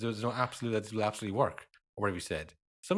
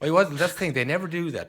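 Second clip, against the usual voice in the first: emphatic; 7 semitones higher, 6 dB louder than usual. Real, not acted. Emphatic correction about pundits.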